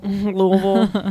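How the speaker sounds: Louisville squashed to a slow "Louvul"; local pronunciation. Retold or imitated. Echoing cohost's example, mocking local pronunciation. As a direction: drag out a mushy two-syllable Louisville. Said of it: "instead of Louisville".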